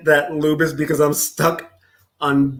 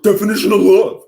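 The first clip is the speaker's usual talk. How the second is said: mocking imitation; 4 semitones higher, 5 dB louder, faster than usual. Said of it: "I fucking hate when he does that".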